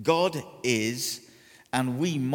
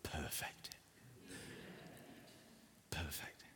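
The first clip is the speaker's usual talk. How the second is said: soft breathy whisper, much quieter; hushed satisfied whisper; whispered perfect; 8 semitones lower, 20 dB quieter than usual. Retold or imitated. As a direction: whisper it to the mirror in awe. Admiring his hair in mirror.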